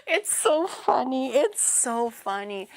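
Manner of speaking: slow, drawn out through real laughter; amused. Real, not acted. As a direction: barely get it out for laughing. Cracking up at the mistake.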